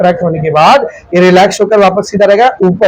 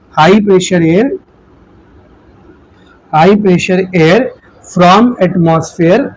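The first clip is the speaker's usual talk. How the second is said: very slow, drawn-out key terms; slow dictation; much slower than usual. Real, not acted. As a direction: spell it out word by word with pauses. Dictates notes with long pauses.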